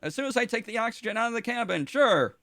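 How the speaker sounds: chirpy AI with a dark joke; deadpan ship AI. Acted. GM voices ship computer Tonic.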